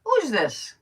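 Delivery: high, loud mock puzzlement; mother's puzzled question. Mother doesn't recognize her daughter, high puzzled voice. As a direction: imitate a mother suddenly confused on the phone. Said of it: "And she goes".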